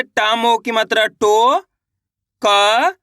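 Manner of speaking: slow, drawn-out "टो", "क", "न" with gaps between; teacher spelling out "टो, क, न" piece by piece; sounding out letters. Shown as a demonstration. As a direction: stretch "टो" and "क", each syllable on its own. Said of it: "एक अक्षर का उच्चारण करके".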